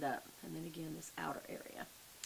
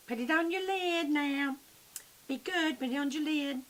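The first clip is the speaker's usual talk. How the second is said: mock scolding mom; 8 semitones higher, 3 dB louder than usual. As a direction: boss the viewer around like a kid.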